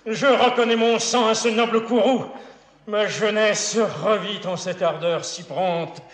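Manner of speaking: high, loud theatrical declamation; ringing pride, easing slightly at the end; proud exultation. Acted. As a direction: exult in your son's fiery spirit. Father exults in son's spirit.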